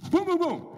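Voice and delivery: loud, high mock door knocks; knocking sound. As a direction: bang out the knocks.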